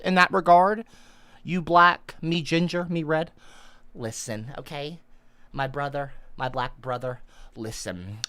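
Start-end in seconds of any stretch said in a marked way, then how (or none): 4.0-8.2 much lower and softer, near murmur; a hammed-up tender brotherly plea; hushed mock sincerity. Streamer softly pleads with a donor as brother.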